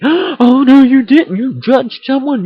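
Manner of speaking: high, loud scandalized gasp of a voice; shrill pretend shock at a sin; mock outrage. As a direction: play the horrified busybody. Mocking a shocked accuser.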